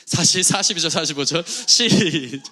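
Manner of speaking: sheepish laughing call to start. Real, not acted. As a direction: chuckle out the verse number after the slip. Preacher laughs after stumbling.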